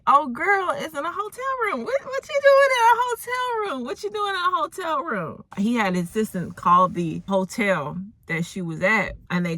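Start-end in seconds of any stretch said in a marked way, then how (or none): 0.1-5.4 high-pitched squeal of shocked disbelief; scandalized exclamation. Acted. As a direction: squeal it like juicy gossip just broke. Mock outrage at hotel discovery.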